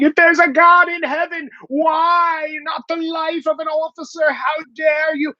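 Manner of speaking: over-the-top mock mourner crying out; mock anguished wail. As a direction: ham up a grieving fist-shaking plea. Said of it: "shaking their fist and going".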